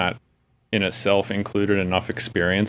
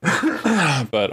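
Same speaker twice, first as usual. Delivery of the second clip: coughing fit; 9 semitones higher than usual. Real, not acted. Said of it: "sorry, I keep coughing".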